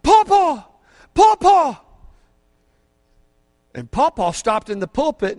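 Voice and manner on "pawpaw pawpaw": loud repeated call, higher; child calling out. Retold or imitated. Grandson spotting pawpaw on stage, shouting gladly. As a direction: call out to grandpa across the room. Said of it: "He yelled out".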